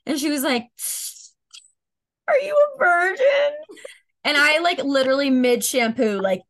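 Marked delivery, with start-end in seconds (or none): 2.3-3.6 put-on high, tearful, loud drunk mother's question; half-sobbing drunk mom, much higher. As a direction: blurt it out high on the edge of crying. Drunk mom asks, near tears.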